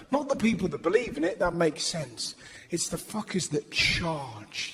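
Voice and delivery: normal talking voice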